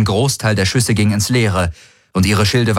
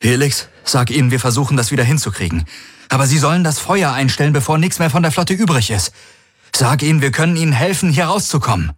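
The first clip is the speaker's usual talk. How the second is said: higher, fast, pressing orders; urgent commander; 7 semitones higher, faster than usual. Acted. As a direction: rush the plea out under fire. Galen pleading under fire.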